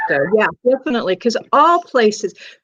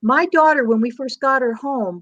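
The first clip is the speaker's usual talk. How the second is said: ordinary delivery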